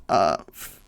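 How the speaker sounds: normal talking voice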